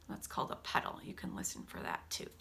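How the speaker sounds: soft whispered aside; hushed teacher aside. Real, not acted. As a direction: lean in and whisper the tip. Whispered aside to listening children.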